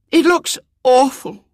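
put-on dismayed little girl's voice; sorrowful. A narrator's reading. Little girl holding up her old dress, sorrowfully. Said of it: "she said sorrowfully".

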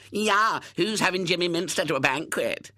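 high, loud schoolboy taunt; jeering shout. A narrator's reading. Hubert jeering at boys outside the window, shouting. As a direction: shout it like a gloating boy. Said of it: "shouted Hubert through the glass".

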